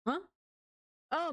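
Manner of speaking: ordinary talk, nothing marked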